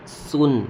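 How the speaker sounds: سن starting with a plain clean s; light hissed s opening سن; careful Arabic seen in سن; sound of seen. Shown as a demonstration. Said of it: "سین کی آواز آئے گی".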